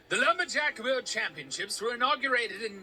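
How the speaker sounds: hammed-up Canadian announcer voice; attempted Canadian accent. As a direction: put on a Canadian accent, badly. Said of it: "we can't get a Canadian accent down".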